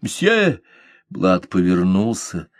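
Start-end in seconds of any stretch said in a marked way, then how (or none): 0.0-0.6 calling out high to stop him; girl stopping the departing captain, high exclamation; loud exclamation. A narrator's reading. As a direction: cry out after the captain. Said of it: "громким восклицанием".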